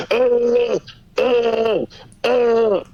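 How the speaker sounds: high held mock grunt of being shocked; short, high, level mock groans; imitated tasing groan. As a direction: imitate someone getting zapped. Imitating Ken getting tased.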